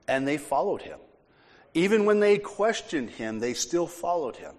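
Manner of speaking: ordinary talk, nothing marked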